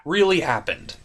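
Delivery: ordinary talk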